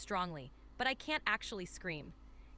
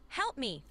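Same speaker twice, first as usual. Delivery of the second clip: restrained emphatic "Help me"; more emphasis. Shown as a demonstration. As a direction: stress on "Help", falling on "me". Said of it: "with even more emphasis".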